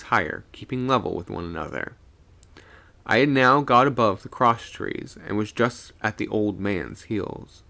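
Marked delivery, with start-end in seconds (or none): none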